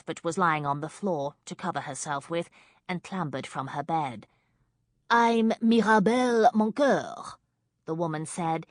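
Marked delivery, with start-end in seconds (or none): 5.1-7.4 put-on slow, loud, heavy French accent; thick French accent. A narrator's reading. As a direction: introduce yourself in smoky French. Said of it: "in a French accent as thick as nightclub smoke".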